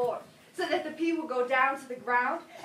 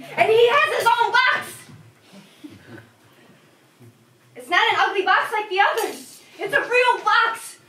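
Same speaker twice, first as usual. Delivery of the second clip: put-on louder, higher, slowed with childlike pride; excited pride; 6 semitones higher, 9 dB louder than usual. Child brags to visitor about grandpa's box, excited. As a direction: boast about it like a treasure.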